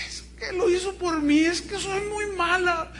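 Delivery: put-on high-pitched tearful little-girl wail; imitated child sobbing. Sobbing daughter confessing to her mother, hammed-up wailing. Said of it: "a mi hija sollozando".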